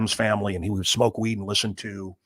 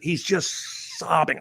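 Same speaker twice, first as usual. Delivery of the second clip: drawn-out, higher-pitched distress; pained emphasis; 6 semitones higher, much slower than usual. Retold or imitated. Describing [name] crying on stage, pained. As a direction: stretch the words out in anguish. Storyteller moved recounting sobbing friend.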